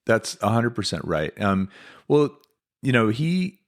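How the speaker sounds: ordinary talk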